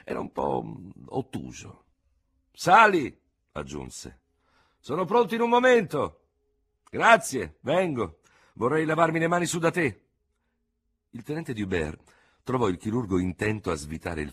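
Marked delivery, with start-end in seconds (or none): 2.5-3.2 high, loud summons; raised, carrying voice; calling down. A narrator's reading. Surgeon calls him upstairs. 4.8-6.3 high, loud call; raised, carrying voice. A narrator's reading. Surgeon calls from window. 6.9-10.1 loud, higher raised voice; projected, high-pitched reply; calling up. A narrator's reading. Dubert calls up to window.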